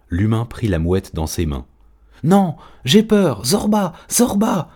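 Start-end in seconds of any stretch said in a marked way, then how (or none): 2.2-4.7 very high, slow, drawn-out frightened cries; pleading wails from a terrified chick; panicked cry. A narrator's reading. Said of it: "cria-t-elle".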